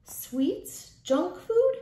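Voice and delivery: mock disbelief, pitch shooting up on food; incredulous question. Teacher quizzing the kids in playful disbelief. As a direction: ask it like that's a silly idea.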